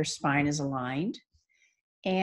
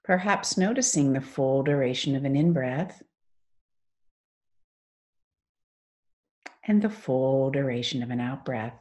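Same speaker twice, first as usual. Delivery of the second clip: guided meditation. Acted. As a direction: ease them into stillness, phrase by unhurried phrase.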